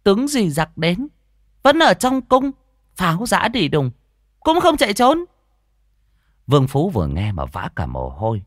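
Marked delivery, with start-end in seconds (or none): 0.0-5.2 put-on very high, loud child voice; village boy posing riddle. Boy challenges Vương Phú with riddle, piercing childish voice.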